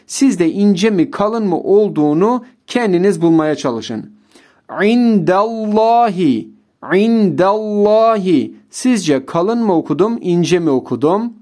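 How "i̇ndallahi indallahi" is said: İndallahi with a dark, heavy lam; heavy lam in indallahi; thick lam. Shown as a demonstration. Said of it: "Evet, kalın okudum".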